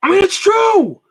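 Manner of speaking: loud, high-pitched outburst of indignation; fired-up shout. Real, not acted. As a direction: yell it like the point is beyond debate. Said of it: "That rant h-, was hilarious".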